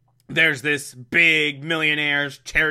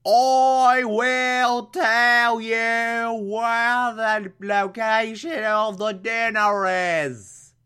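very slow, high British accent, hammed up; 8 semitones higher, much slower than usual. Redoing Alfred's brainwashed line as British, slow high accent. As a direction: overdo a posh British trance voice. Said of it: "he's British, so it'd be more like".

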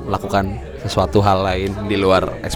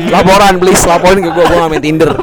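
excited protest; 6 semitones higher, 5 dB louder than usual. Real, not acted. Protesting he is not on Tinder.

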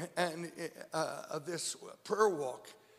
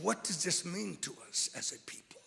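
whisper; 6 dB quieter than usual. Acted. Preacher drops to a whisper.

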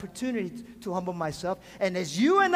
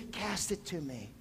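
tender Jesus; 7 semitones lower, 11 dB quieter than usual. Acted. Jesus voiced tenderly to listeners.

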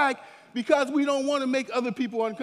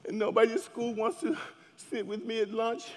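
put-on softer, whiny complaining teen; sulky teenager; 6 dB quieter than usual. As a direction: grumble like an upset teenager. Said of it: "sometimes teenagers get upset".

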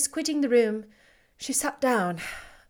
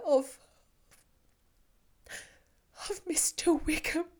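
higher, shaken with whispered breaks; distraught; 6 semitones higher, 4 dB louder than usual. Acted. Elizabeth telling Darcy of the elopement, distraught and breaking. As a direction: confess the disaster, voice breaking.

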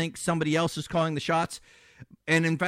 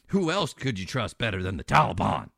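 ordinary talk, nothing marked

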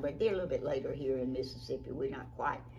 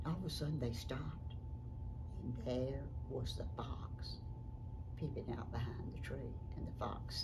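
hushed storytelling; 2 semitones lower, 6 dB quieter than usual. A narrator's reading. Storyteller reveals the peeping fox, hushed. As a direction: drop right down for suspense.

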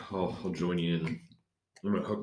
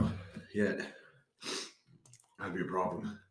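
ordinary talk

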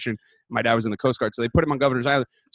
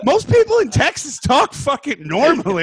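high, loud fed-up comeback; high and loud, half-joking indignation; exasperated retort; 7 semitones higher, 4 dB louder than usual. Retold or imitated. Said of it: "I'm like".

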